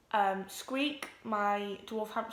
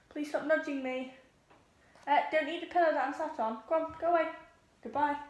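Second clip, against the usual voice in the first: higher-pitched telling-off of a pet; shooing a pet; 6 semitones higher, faster than usual. Real, not acted. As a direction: shoo the animal away in a higher voice.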